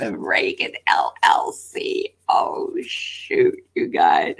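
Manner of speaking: hushed conspiratorial whisper; breathy secret aside to viewers. Real, not acted.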